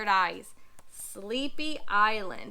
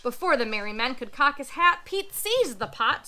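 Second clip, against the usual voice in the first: ordinary delivery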